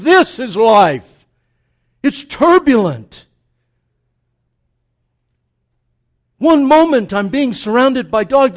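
loud, insistent sermon delivery; preaching at full force. Acted. Preacher insisting David is a real man, loud and insistent. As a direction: drive it home.

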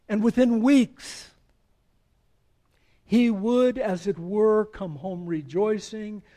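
loud, slow, building quote read; full-voiced preached quotation; preaching a quote. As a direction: swell the quote to a ringing close. Reading quote at full force.

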